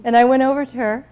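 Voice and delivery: normal talking voice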